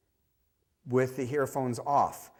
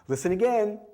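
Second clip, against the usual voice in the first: higher, brighter listen again; bright coaxing cue; 9 semitones higher, 5 dB louder than usual. Shown as a demonstration. Prompting a child at the screening, bright coaxing.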